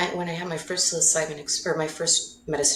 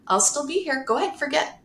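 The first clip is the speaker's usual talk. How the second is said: sassy, teasing mother voice, pitched higher; 10 semitones higher, 4 dB louder than usual. Retold or imitated. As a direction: tease like a cheeky mother who knows best. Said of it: "she kept being like".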